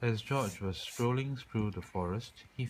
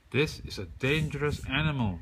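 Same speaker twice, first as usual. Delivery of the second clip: loud roaring warning; roared; 6 semitones higher, 5 dB louder than usual. A narrator's reading. Chief warning the girl about the bear, loud roar. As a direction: roar it at the crowd. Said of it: "roared the chief".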